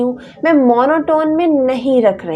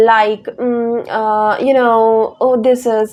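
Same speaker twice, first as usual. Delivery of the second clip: filler words demo; 2 semitones lower, much slower than usual. Shown as a demonstration. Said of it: "no, don't use this kind of words".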